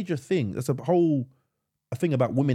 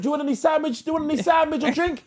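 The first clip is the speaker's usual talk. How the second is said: high sing-song offer; high mock nurse; 12 semitones higher, faster than usual. Nurse offering food to the patient, high sing-song. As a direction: chirp it like a bored carer. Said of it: "they like".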